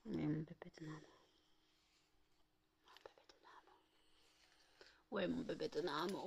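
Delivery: murmuré tout bas, presque soufflé; tendre chuchotement. Real, not acted. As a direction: berce le chien en murmurant. (murmure tendre au chien)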